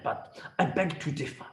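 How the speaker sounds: usual speaking voice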